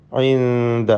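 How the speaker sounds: ഐന്ദ with the n hidden in a held hum; hidden noon. Shown as a demonstration. Teacher shows students ikhfa, ഐന്ദ held nasally. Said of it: "ഇഖ്ഫാ ചെയ്യണം സുക്കൂനുള്ള നൂനിനെ".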